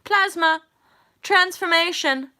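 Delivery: loud, high, slowed announcing; exuberant proclamation. Real, not acted. Declaring her alchemy to viewers, loud and gleeful. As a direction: proclaim it with no shame. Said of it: "I have no shame".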